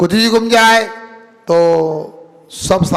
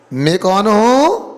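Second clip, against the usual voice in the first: ordinary delivery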